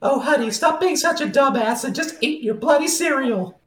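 high put-on scolding wife; hammed-up giant's wife nagging her husband. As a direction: nag him in a high silly voice.

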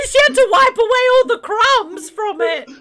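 high, loud, through laughter; laughing. Real, not acted. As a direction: talk while cracking up. Laughing while talking.

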